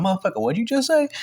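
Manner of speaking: high, indignant comeback; incredulous challenge. Retold or imitated. Speaker challenging the man backing away, indignant. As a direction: act out disbelief, pitch jumping up. Acted confrontation after an insult.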